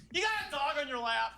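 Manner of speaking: high, pointed question; mock accusing question. Driver at a red light needling the woman, high and pointed. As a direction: call her out on the dog. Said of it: "I just look over and I go".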